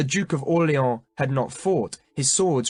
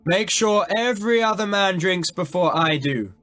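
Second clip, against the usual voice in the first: high, loud carrying command; loud order; 7 semitones higher, 4 dB louder than usual. A narrator's reading. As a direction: project it for the whole line to hear. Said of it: "in a voice loud enough to be heard thirty or forty paces".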